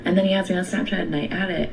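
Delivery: ordinary delivery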